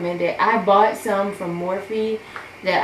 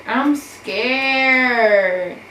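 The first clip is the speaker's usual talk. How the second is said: louder, higher cry of "scared"; panicked cry; 5 semitones higher, 7 dB louder than usual. Real, not acted. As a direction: blurt it out in rising panic.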